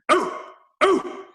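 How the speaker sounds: high, loud exclamation of delight; mock ecstatic moan. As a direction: imitate the singer swooning over the cymbal. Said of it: "leans over the cymbal and goes".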